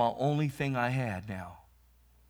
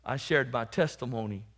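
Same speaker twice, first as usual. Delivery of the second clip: ordinary talk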